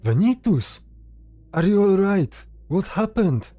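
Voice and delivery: high, loud cry of alarm; alarmed shout. Acted. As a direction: call out urgently to a fallen friend. Brother runs to fallen Venitus.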